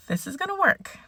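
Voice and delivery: sing-song self-reassurance; high hopeful repeat. Real, not acted.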